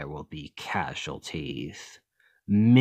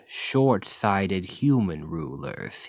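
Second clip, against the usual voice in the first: usual speaking voice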